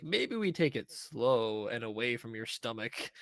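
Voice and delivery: slow, cautious coaxing; slow careful advice. Retold or imitated. Wife eases the girl away from the knife, slow and careful. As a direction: spell it out slowly, carefully. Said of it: "And she's like".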